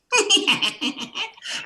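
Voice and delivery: very high, tittering Morph laugh; happy-go-lucky high-pitched cackle; high happy-go-lucky cartoon laugh. Shown as a demonstration. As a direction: giggle way up high, carefree. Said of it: "the laugh went up".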